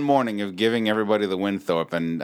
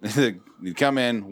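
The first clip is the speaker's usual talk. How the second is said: normal talking voice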